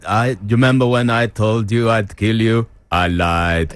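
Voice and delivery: put-on Austrian accent, deadpan action-hero line; Schwarzenegger impression. Co-host asked for his Schwarzenegger, Austrian accent. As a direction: deliver it like Arnie in Commando. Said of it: "Do you do a Schwartzy?".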